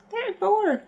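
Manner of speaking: high-pitched squeak of disbelief; disbelieving exclamation. Real, not acted. Vlogger reacting to turning thirty-four, high-pitched disbelief. Exclaims at own coming age.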